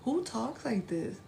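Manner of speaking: talking funny in a high voice; silly put-on voice. Real, not acted. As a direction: lilt it up high, mocking your own way of talking. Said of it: "Why am I talking like this?".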